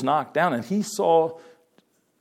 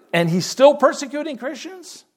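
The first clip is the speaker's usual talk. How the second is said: pitched way up in disbelief; incredulous question; 11 semitones higher than usual. Real, not acted. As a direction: ask it as if you can't believe it. Rhetorical disbelief at Saul persecuting.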